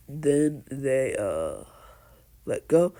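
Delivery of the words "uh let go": ordinary delivery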